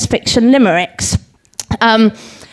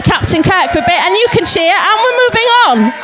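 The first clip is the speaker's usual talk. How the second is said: building blag; 8 semitones higher than usual. Real, not acted. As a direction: pitch climbing steadily to a high finish. Blagging over cheers after forgetting the verse.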